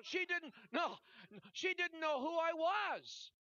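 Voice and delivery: loud, high-pitched preacher at full force; urgent pulpit shout; impassioned preaching. Real, not acted.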